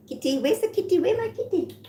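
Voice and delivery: high coaxing call to the cat; calling the kitty. Real, not acted. Calling her cat in baby voice.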